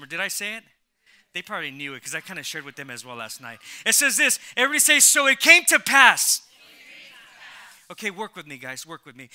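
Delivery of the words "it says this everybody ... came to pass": preaching at full force. Real, not acted. Pastor to congregation, calling them to repeat, loud preaching. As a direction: lift the room with every word.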